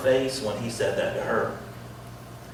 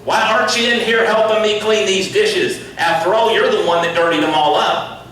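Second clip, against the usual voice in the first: loud, higher nagging mom; scolding mother; 7 semitones higher, 6 dB louder than usual. Retold or imitated. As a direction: scold the kids like an exasperated mom. Mother scolding kids over dishes.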